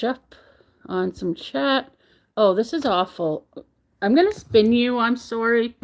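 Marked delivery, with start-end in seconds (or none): none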